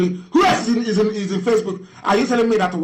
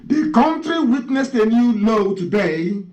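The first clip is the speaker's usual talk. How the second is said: slow, deliberate reading, higher; reading very slowly; 4 semitones higher, slower than usual. Shown as a demonstration. Radio host reading a quote slowly to mock listeners, deliberate. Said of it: "I'll read very slowly so you understand it".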